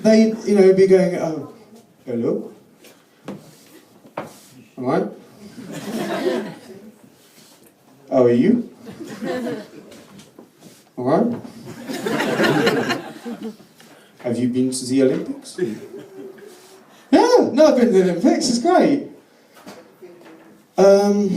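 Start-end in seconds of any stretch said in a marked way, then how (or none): 1.2-15.6 put-on French accent. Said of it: "even the Frenchman I spoke to". 17.1-19.1 high, eager small-talk answer; mock enthusiastic English small talk; chirpy reply. Own chirpy reply to the Frenchman.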